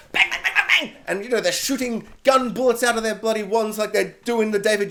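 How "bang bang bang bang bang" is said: mock gunfire. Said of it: "they're like".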